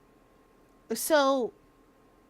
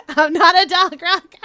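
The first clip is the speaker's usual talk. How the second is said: loud, slow, breaking into laughter; failed bass voice attempt; 7 semitones higher, 15 dB louder than usual. Shown as a demonstration. Streamer attempts a character voice, loud and dissolving into laughter. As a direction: try a bass voice and crack up. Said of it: "I can't manage the bass voice".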